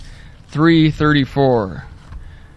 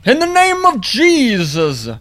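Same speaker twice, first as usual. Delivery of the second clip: high, slow, hammed-up faith healer; mock commanding preacher; 10 semitones higher than usual. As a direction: ham up a preacher commanding the dead. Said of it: "Corpse wouldn't do it".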